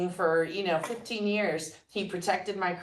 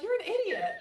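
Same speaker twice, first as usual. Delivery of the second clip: laughing self-mockery. Laughing at own past self.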